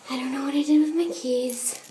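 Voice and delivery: normal talking voice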